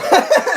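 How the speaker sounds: high, loud burst of laughter. Real, not acted. Laughs at the gushing quote.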